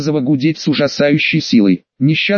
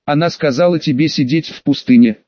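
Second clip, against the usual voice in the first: plain reading voice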